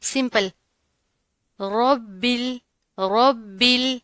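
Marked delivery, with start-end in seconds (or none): 1.6-4.0 رب with full thick ر; heavy ra. Shown as a demonstration. Teacher shows students heavy ر in رب ال. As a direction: darken ر in رب ال. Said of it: "اس کو موٹا پڑھنا ہے".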